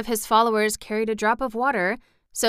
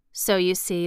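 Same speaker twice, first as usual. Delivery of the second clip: plain reading voice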